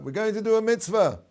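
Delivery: high, loud called reply; children yelling back as they run out; shouting back. Retold or imitated. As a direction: shout it back over your shoulder. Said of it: "So they shouted back".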